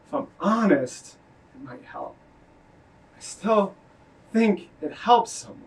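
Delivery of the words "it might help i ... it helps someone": breathy whisper, barely there. Acted. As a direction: whisper it to yourself. Quiet confession in poem.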